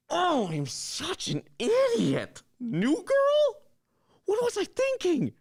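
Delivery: squeaky high self-mocking wail; frustrated wail. Gamer berates himself after girl stands him up, high wailing. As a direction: throw your voice way up in despair. Gamer realizes he's been stood up.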